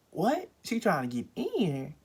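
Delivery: a high, loud startled what then stunned question; shocked disbelief. Retold or imitated. Realising the stranger is trying the doorknob, high shocked disbelief. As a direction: jolt up in pitch, caught off guard.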